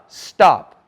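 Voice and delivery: a loud final command, not rude; firm stop. Shown as a demonstration. Warning a stranger who keeps stepping closer, loud and firm. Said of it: "Did you hear my tone differentiation?".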